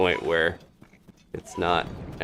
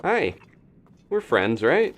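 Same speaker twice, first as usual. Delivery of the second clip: higher, louder nervous greeting; nervous friendly; 5 semitones higher, 4 dB louder than usual. Real, not acted. Gamer sweet-talking a club-wielding monster, higher nervous cheer. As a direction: placate the monster with a bright nervous hello.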